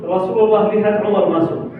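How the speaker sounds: usual speaking voice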